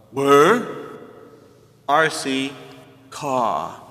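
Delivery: lightly voiced caveman asking for his cola; a hint of a grunting Stone Age man; mock caveman order; Flintstone voice. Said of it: "impersonation of Flintstones".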